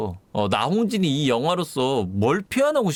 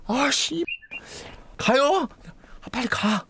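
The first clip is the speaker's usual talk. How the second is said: high-pitched jittery hesitation; mock nervous; 10 semitones higher, slower than usual. Cop psyching himself up to enter, mock scared. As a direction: act out a cop dreading going in.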